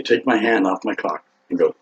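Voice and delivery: normal talking voice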